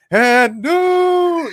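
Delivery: booming high announcer "And new"; ring announcer call. Retold or imitated. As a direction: belt it like a ring announcer with everything you've got. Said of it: "with everything I had, I went".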